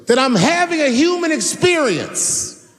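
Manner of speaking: very high, drawn-out heartfelt declaration; imitated impassioned cry. Daphne to the comedian before a hushed crowd, imitated impassioned plea. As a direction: raise the pitch far up and plead it, imitating her. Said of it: "just like this. She goes".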